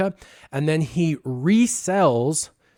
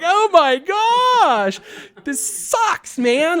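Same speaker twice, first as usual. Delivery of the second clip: mortified; 6 dB louder, much slower than usual. Retold or imitated. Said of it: "I'm like".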